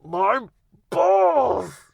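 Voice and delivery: very high, loud squealing yell; squeaky cry of pain. Retold or imitated. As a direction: shriek it in agony. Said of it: "They thought I was in shock".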